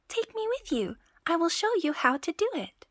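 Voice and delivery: very high, small eager voice; tiny Tom Thumb voice. Acted. Tom Thumb begs the thieves to take him, high.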